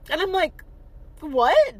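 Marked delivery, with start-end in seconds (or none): 1.2-1.8 loud, incredulous "what"; stunned, louder outburst; shocked. Retold or imitated. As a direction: blurt it out in disbelief. Retells shocked reaction to insult.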